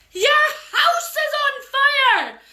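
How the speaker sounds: high, slow, laughing shout; lady tricking the fairies with a shout; fake alarm.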